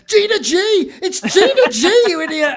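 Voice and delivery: high, loud, hammed-up yell; shouting. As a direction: holler it at passers-by along the canal. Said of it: "running down the canal shouting".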